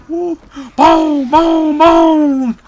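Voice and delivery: high, loud booms, each drawn out; gleeful explosion shouts. Real, not acted. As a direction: shout out each blast in glee. Imitates explosions while firing.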